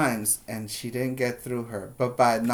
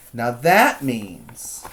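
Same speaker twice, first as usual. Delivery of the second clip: normal talking voice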